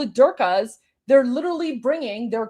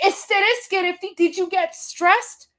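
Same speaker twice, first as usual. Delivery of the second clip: higher, sing-song mock concern; mock-caring host; 7 semitones higher than usual. Imitating the host asking viewers, higher mock concern. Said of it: "He's like".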